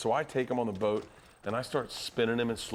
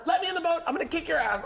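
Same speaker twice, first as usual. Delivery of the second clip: high-pitched enraged imitation; mock furious threat; 3 dB louder, faster than usual. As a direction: yell from the water at the boat. Friend stranded in water, threatening.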